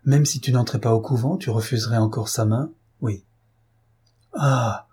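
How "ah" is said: short startled cry, lightly done; a mild gasp of surprise; exclaiming. A narrator's reading. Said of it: "S'écria madame Desmarais".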